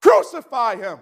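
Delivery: high, slow mob shout; crowd shouting. Acted. Preacher voicing the crowd rejecting Jesus, shouting. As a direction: cry it out as the crowd. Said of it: "we read it".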